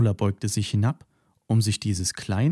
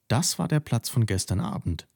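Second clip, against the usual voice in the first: plain reading voice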